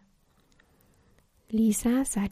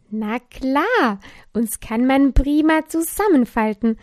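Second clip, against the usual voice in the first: fröhliche Wut; 3 semitones higher, 8 dB louder than usual. A narrator's reading. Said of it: "sagte die Wut".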